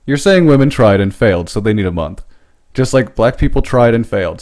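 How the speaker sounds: ordinary delivery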